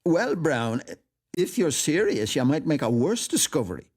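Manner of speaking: high, insistent character voice; high-voiced, emphatic gusher; earnest, effusive admirer. A narrator's reading.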